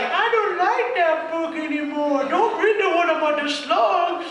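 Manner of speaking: put-on a kid swearing off the scary book; scared little kid. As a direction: whine it like a frightened small child.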